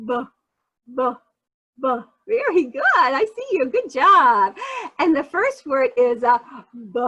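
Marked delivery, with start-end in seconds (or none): 0.1-2.0 a short, voiced B puffed out alone, like buh; B sound. Shown as a demonstration. Teacher demonstrating the B sound for kids to copy. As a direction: pop the B sound over and over. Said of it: "make the B sound go B".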